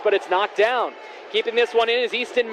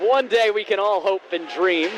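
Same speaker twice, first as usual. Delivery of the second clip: ordinary delivery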